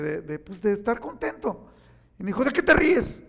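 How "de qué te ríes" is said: high, loud snapping question; irritated man barking at a smiling stranger; angry challenge. Retold or imitated. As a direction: demand it sharply. Angry man scolds speaker.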